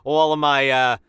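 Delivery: wicked smart therapist talk in thick Boston; Boston accent impression. Pat praising his therapist in a loud Boston accent. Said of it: "you're a goddamn impressionist".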